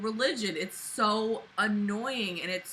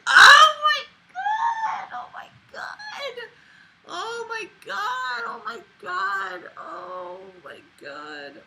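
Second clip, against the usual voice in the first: anguished cries; 14 semitones higher, 7 dB louder, slower than usual. Real, not acted. Wailing in disbelief at the diary's reading. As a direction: wail it out in disbelief. Repeated outraged exclamation.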